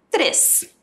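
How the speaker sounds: ordinary talk, nothing marked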